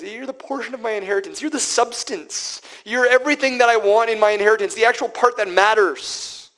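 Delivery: high, loud, driving delivery of the psalm; fervent preaching. Real, not acted. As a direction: proclaim it with mounting fire.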